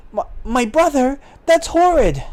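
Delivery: high, acted horror; stammer, then pitch up in dismay; aghast heroine, very high; shocked sister. Sister hears brother was drowned.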